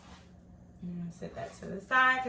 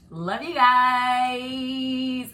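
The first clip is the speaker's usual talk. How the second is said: drawn-out; 5 semitones higher, 11 dB louder, much slower than usual. Real, not acted. Sign-off to viewers.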